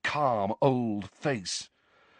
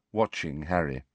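usual speaking voice

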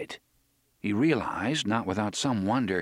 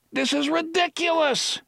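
shouted. Acted. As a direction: blast it out high in exasperation. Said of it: "Eddie shouted".

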